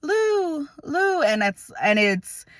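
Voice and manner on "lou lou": very high, wavering cries of a name; a hint of an aunt's tearful calling, voiced much higher; imitating a crying aunt. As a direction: echo the aunt calling the grandmother through her tears. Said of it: "she's saying my grandma's name".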